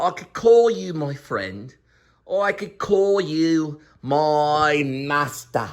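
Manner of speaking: ordinary talk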